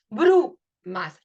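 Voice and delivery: "brumas" with BRU punched loud, "mas" apart; stressed syllable. Shown as a demonstration. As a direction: hit the U in "brumas" hard. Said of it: "el fonema que se acentúa".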